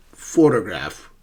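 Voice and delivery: "Photograph" with PHO strong, then falling; first-syllable stress. Shown as a demonstration. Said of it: "the first syllable is stressed".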